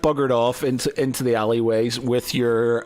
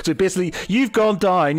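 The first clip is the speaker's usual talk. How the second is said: ordinary talk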